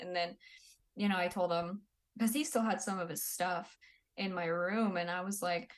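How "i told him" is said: usual speaking voice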